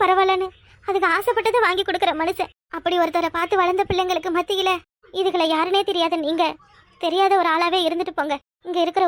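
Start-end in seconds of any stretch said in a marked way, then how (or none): none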